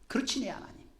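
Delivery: soft whisper, realizing; whispering the same words back; whispered prayer. Acted. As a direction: breathe it out as a quiet prayer. Preacher voices David's prayer.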